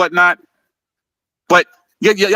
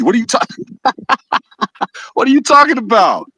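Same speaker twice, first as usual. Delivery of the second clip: incredulous; 7 semitones higher than usual. Real, not acted. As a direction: scoff at how absurd the claim is. Mocking a claim from the video.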